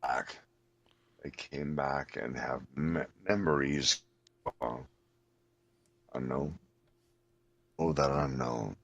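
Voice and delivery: low, soft, moaning ghost; eerie ghost voice. Pretend. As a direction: moan it from beyond the grave. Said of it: "my sound effects for dying on the Titanic".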